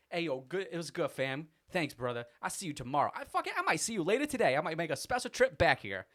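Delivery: high, lightly voiced buddy farewell; Corey leaving with the six-pack; cheerful goodbye. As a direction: wave him off warmly. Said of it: "just was like".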